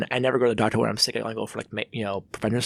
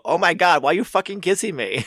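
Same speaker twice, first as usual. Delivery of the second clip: shocked outburst; 6 semitones higher than usual. Retold or imitated. Retold outburst at kissing woman.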